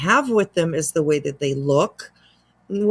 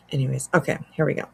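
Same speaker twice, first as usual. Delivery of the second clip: ordinary talk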